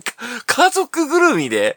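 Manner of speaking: ordinary talk, nothing marked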